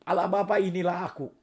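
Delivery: usual speaking voice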